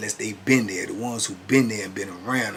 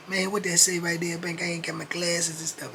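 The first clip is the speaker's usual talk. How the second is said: lightly voiced cellmate, raised pitch, making excuses; a hint of a proud man covering up; Keith's voice; 7 semitones higher than usual. Cellmate covering that he can't read, lightly voiced.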